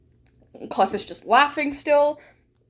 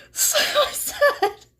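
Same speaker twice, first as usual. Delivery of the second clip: can barely get the words out for laughing; laughing while telling; 8 semitones higher than usual. Real, not acted. Streamer recounting her own prank line, laughing. Streamer cracking up reading chat.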